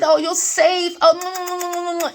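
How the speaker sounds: high, loud, giggling through the words; high giddy swooning with laughter; giddy laughing. Retold or imitated. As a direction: laugh and swoon over him. Recalls kissing in dream.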